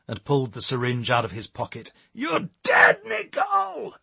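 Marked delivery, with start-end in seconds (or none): 2.1-4.0 loud, high-pitched yelled threat; yelling. A narrator's reading. Eric yells after fleeing Nicole, furious yell. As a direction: scream the threat after someone running away. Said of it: "he yelled at her".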